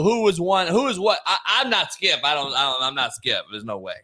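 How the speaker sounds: normal talking voice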